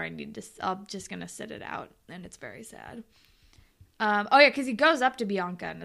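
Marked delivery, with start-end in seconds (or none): 0.0-3.0 soft, fading mopey voice; a soft, drooping mock of Adam; mock sad mumble. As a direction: trail off pathetically. Mocking Adam's sad excuse.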